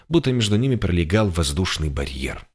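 ordinary delivery, nothing marked

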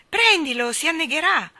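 high, loud panicked cry; anxious shout. A narrator's reading. Michael, breathless, shouts at the boy to catch the dolphin. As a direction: shout the warning, alarmed. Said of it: "gridava Michael affannato".

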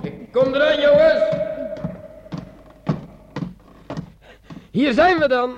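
loud, cheery call to the men. Acted. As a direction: announces his arrival, loud and proud. Sergeant arrives, calling out. (luid roepend tegen de kerels)

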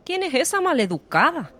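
high, loud indignant question. A narrator's reading. Admirer to her companion about the rude woman, indignant. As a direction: ask it in high-pitched outrage. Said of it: "indignada ante la escena".

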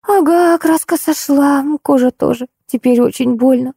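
high, drawn-out pitiful whine; plaintive drawl. A narrator's reading. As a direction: stretch it out, feeling sorry for yourself. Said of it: "жалобным голосом протянула Ольга". (протяжно, жалобным высоким голосом)

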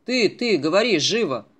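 loud, sharp command; a menacing captor yelling at a scared boy; angry shout. Acted. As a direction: shout him into answering. Captor presses frightened boy.

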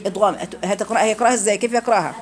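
ordinary talk, nothing marked

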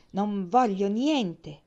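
slow, loud sulky refusal. A narrator's reading. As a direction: refuse with a heavy pout. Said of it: "disse imbronciato".

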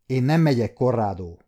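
plain reading voice

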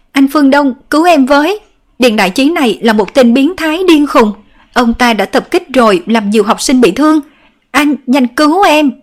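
high, loud frantic plea; panicked pleading. A narrator's reading. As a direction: beg for rescue in terror. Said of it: "Lâm Hiểu Vũ hoảng hốt".

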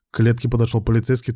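ordinary delivery

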